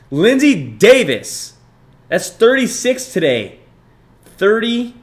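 excited shout-out. Real, not acted. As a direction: call the donor's name like a hype announcer. Thanking a donor on stream.

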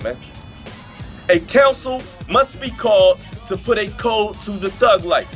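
ordinary delivery

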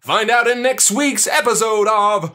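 high, drawn-out show sign-off; mock announcer. Host teasing next week's episode, hammed-up announcer voice. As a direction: stretch out the hero's name like a cartoon announcer.

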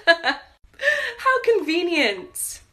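slow, high, laughing sarcasm; sarcastic laugh. Acted. Waitress mocking forgetful customer, slow laughing sarcasm. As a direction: drip sarcasm through a laugh.

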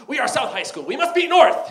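building to a high loud chant; mock rally cry. High schooler rallying his team, building to a loud cheer. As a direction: pump up the team. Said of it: "it's like".

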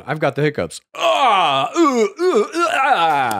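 high, loud imitated gasping; hammed-up high hiccup imitation; mock hiccup gasps. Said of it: "now you're just going".

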